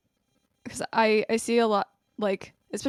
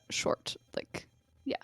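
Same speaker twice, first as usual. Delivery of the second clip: whisper; 12 dB quieter than usual. Real, not acted. Aside to listeners while guest talks.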